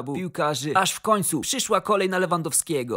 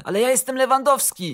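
higher, louder protest; indignant insistence; 7 semitones higher, 5 dB louder than usual. A narrator's reading. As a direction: insist on who you are, voice raised. Unrecognised star insists at reception. (oburzone, podniesione naleganie)

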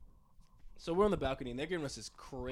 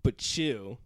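defiant; 12 dB louder, slower than usual. Retold or imitated. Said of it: "I'm like".

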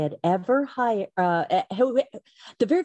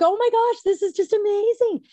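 very high-pitched excited squeal; thrilled reaction; 14 semitones higher, 3 dB louder than usual. Retold or imitated. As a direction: re-enact being thrilled, voice way up. Retells thrilled reaction to Walmart news.

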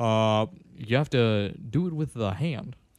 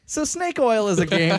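ordinary talk

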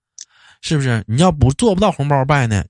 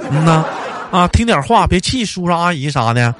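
higher, chatty, overly polite greeting; polite New Year small talk; 5 semitones higher than usual. Retold or imitated. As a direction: gush politely at a friend's parents. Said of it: "就基本上这种客套话呗".

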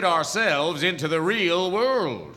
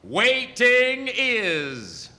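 cryptic slow pronouncement; 6 semitones higher, much slower than usual. Acted. Wizard offers cryptic wisdom.

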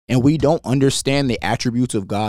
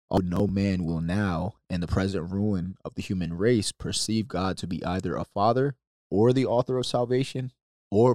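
reading aloud; 4 semitones lower, 5 dB quieter than usual. Acted. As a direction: read it like a solemn quotation. Reads Calvin quote aloud.

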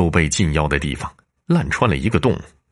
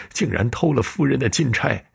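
偷 said higher, weeping, falling away at the end; tearful admission; 7 semitones higher than usual. Acted. Servant tearfully admitting he stole 金钗 from the mistress. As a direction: confess 偷了夫人的金钗 through tears. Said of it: "陈小二眼角渗出泪水".

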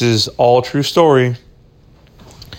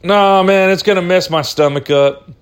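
lightly voiced guy turning down the food; homeless guy refusing; 7 semitones higher than usual. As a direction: toss it off like a picky stranger. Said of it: "he's like".

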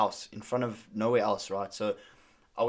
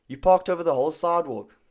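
imitated irritation, raised and a bit louder; retold angry complaint; 5 semitones higher, 5 dB louder than usual. Angry stranger repeats himself to pizza driver, retold angry complaint. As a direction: repeat the accusation, pitched up and firm. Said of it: "he's angry now".

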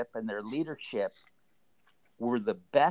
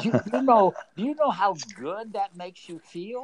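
higher-pitched, excited rush of pride; real delight; 7 semitones higher, 6 dB louder than usual. Real, not acted. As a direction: burst out, thrilled by the good news. Delighted at her good news.

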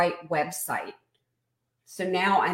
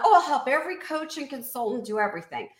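higher, hurried over-keen pitch; mock eagerness; 6 semitones higher, faster than usual. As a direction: lightly parody someone promising the world. Mocks an overbroad coach's pitch.

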